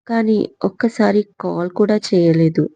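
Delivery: ordinary delivery, nothing marked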